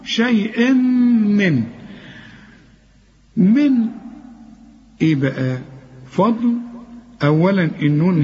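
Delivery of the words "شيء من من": "شيء من" drawn out very slowly, the نون of the tanween hummed long through the nose before م; nasal ghunna held. Shown as a demonstration. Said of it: "لازم تغن".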